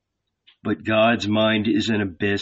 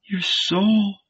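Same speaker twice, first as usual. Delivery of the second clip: serpent woman voice; 7 semitones higher than usual. A narrator's reading. Serpent answers.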